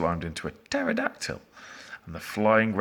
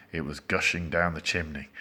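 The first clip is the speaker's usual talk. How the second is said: ordinary delivery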